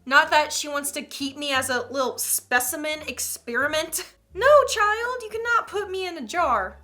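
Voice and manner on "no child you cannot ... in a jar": high, loud mock scolding. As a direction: scold the child, half joking. Scolding the child in the story.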